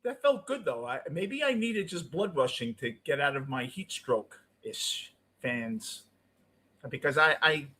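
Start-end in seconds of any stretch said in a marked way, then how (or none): none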